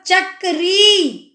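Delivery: चक्री stretched out with a long ई, slowly; slow sounding out. Shown as a demonstration. Teacher sounding out चक्री. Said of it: "बड़ी ई की मात्रा लग रही है".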